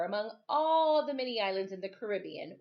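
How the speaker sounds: ordinary delivery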